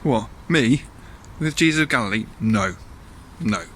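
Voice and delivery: incredulous echo, falling to a short no; Peter's denial. Acted. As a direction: act taken aback, then deny it firmly. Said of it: "And Peter says".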